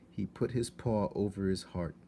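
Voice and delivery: ordinary delivery, nothing marked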